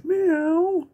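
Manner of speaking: very high cat meow. Retold or imitated. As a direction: mew like a cartoon cat. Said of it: "And Harmony going".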